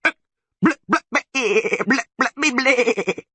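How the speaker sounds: very high, loud bleating fit; furious gurgling. A narrator's reading. Goat creature bares its teeth, gurgling furiously. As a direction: babble and bleat like an enraged goat. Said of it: "The creature gurgled furiously".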